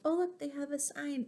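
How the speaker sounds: plain reading voice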